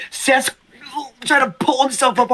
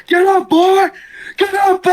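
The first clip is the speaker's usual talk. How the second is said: very high-pitched acted yell; mock taunting shout; 12 semitones higher than usual. The Rock going at the fallen man, taunting yell. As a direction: goad him in a high strained cry.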